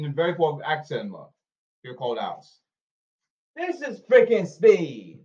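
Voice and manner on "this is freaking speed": loud, drawn-out, raised voice; emphatic declaration. Shown as a demonstration. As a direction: punch it out slowly and higher. Demonstrating speed for the camera.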